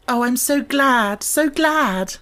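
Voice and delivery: very high, slow, stressed "so"; thankful. A narrator's reading. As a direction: pour out glad relief. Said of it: "said Mary thankfully".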